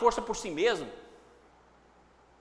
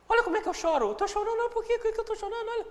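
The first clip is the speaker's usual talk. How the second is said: high-pitched whiny self-observation; acted tearful whine, pitched well up; mock crying; 11 semitones higher, faster than usual. Teacher acts someone watching themselves cry, mock tearful. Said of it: "na hora que você tá chorando".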